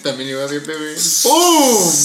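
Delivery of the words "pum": excited shout. Real, not acted. Punchline sound effect shout.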